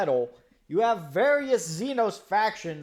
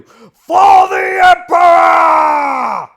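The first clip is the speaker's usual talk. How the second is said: slow roaring war cry, much louder; battle cry; 11 semitones higher, 19 dB louder, much slower than usual. Acted. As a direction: bellow it like a charging soldier. War cry quoting Warhammer.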